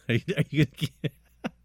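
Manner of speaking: giggling, words breaking up; can't stop laughing while talking; laughing through words. Real, not acted. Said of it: "I can't even talk".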